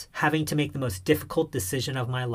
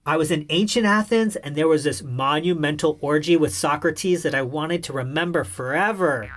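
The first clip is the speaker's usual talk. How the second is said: normal talking voice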